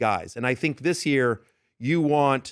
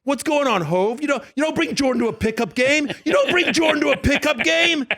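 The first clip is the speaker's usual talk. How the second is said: high-pitched outraged protest, louder on the repeat; 12 semitones higher, 4 dB louder than usual. Retold or imitated. Loud guy objecting to [name] about a newcomer, mock yell. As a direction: imitate a loud guy hollering his objection across a room. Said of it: "the guy that was yelling is [name]".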